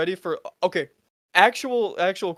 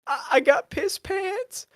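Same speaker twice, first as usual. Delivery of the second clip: much higher, slow pitiful mock whimper; whiny old man; 10 semitones higher, slower than usual. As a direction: play a feeble old man confessing. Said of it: "Biden ... was just like".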